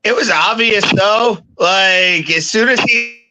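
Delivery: loud, high, straining to be heard; shouting through noise; yelling over helicopter. Real, not acted. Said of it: "Sound like you're in a helicopter".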